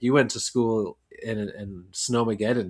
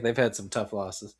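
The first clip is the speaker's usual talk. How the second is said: ordinary talk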